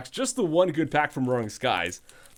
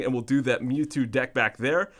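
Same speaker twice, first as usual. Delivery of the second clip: ordinary delivery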